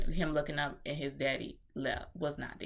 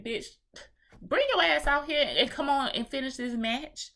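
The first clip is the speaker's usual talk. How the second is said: impatient heckle; 9 semitones higher, 6 dB louder than usual. Retold or imitated. Impatient crowd voiced at Venus.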